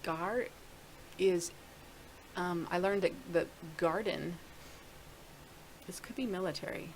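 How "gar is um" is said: normal talking voice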